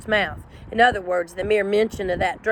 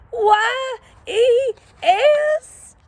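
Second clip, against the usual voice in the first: high, slow, letter-by-letter whimper; a high, drawn-out, spelled yes; Drover spells it; 4 dB louder, much slower than usual. A narrator's reading. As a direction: squeak each letter out in fear.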